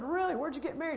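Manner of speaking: high-pitched retold surprise; surprised questioning. Retells own astonished reply.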